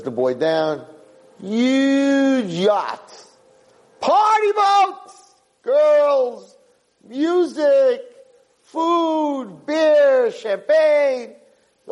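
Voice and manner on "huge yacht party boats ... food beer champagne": high, thrilled listing of party luxuries; excited. A narrator's reading. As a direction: sell the party boat like a wild dream. Describing the lavish party boat.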